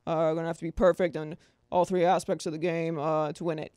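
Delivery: very still, droning coach monotone; Bill Belichick. Retold or imitated. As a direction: keep it still and level, like a coach at the podium. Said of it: "I need to be more still".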